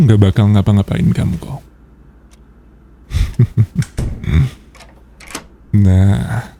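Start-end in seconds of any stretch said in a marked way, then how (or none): 3.1-4.5 short sly chuckle; teasing chuckle. Acted. Boss laughs at her obedient employee, a sly chuckle.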